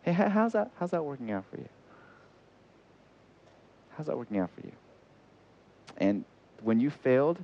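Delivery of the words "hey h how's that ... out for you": Jesus gently asking. Acted. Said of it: "Jesus looks at you and me in our failure".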